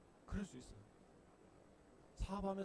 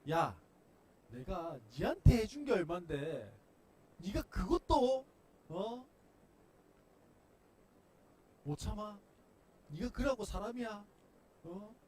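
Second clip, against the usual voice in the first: loud, slow high rebuke; loud parental telling-off; scolding; 5 semitones higher, 10 dB louder, slower than usual. Pretend. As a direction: scold the ungrateful child. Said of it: "채근하시고 야단치실까요".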